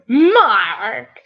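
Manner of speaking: hammed-up Irish-accented call; attempted Irish accent. Shown as a demonstration. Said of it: "I can do in the Irish voice".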